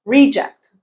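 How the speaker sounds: "Reject" as REE-ject; stress on first syllable. Shown as a demonstration. Teacher summing up the noun form, first-syllable stress. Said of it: "you change the stress pattern and the vowel".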